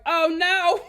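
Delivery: loud, high mock objection; imitating outraged pastor. Host acting Pastor Kyle rejecting undecided, loud mock outrage. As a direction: belt out a hammed-up refusal. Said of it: "Pastor Kyle was like".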